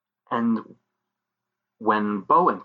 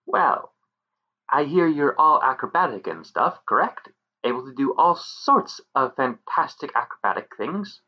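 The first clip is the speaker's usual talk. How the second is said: high teasing fairy voice; goading, sly pixie; 4 semitones higher than usual. Acted.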